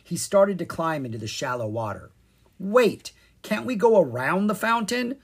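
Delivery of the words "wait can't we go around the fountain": high, loud startled objection; urgent, raised plea; alarmed protest. A narrator's reading. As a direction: stop him before he climbs in. Kara objecting to wading in.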